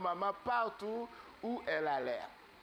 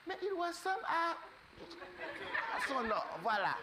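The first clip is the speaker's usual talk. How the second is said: much higher, drawn-out wondering voice with a laugh; marvelling ducklings; 11 semitones higher, slower than usual. Acted. Ducklings noticing the big bird looks like their brother, high amazed voice. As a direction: stretch it out in amazement, pitched high.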